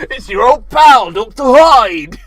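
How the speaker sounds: big, loud, slow hammed-up monster voice; monster villain. Hyde introduces himself to the audience in a loud, slow hammed-up monster boom. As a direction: boom it out like a gleeful movie monster.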